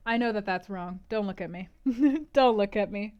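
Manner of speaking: plain reading voice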